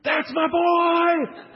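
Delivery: high, drawn-out proud shout; real loud scream. Retold or imitated. Excited dad cheering son's basket, screamed real loud. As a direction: yell it slowly at full volume from the stands. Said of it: "He's real loud".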